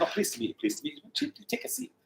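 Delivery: small, hushed polite greeting; flustered politeness. Retold or imitated. Hairdresser greeting the Queen's P A, softly flustered. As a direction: murmur it softly, mock-demure.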